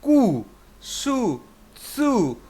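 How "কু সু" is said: কু, সু stretched very slowly and higher, long উ held; drawn-out syllables. Shown as a demonstration. Teacher asking students to say it aloud, slow and high. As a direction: sing out the উ of কু and সু. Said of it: "একটু উচ্চারণ করেন মুখে মুখে".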